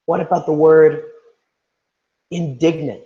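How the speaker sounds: ordinary talk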